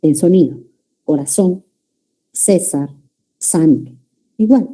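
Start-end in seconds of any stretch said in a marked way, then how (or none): 1.0-3.9 "Corazón", "César" with a plain S sound, Latin American seseo; seseo demo. Shown as a demonstration. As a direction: say "Corazón", "César", "santo" all with S, never a lisped Z. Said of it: "Todo es S para nosotros, en sonido".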